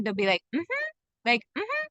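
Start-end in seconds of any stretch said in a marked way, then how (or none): 0.5-0.9 a bright rising mm-hmm of yes; rising hum meaning yes; affirming mm-hmm. Shown as a demonstration. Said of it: "When something is right for them". 1.6-1.9 a bright rising mm-hmm of yes; rising hum meaning yes; affirming mm-hmm. Shown as a demonstration. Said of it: "When something is right for them".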